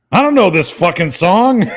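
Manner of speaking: imitating a drunk friend who doesn't know the song; drunk protest. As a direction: complain like a tipsy karaoke singer.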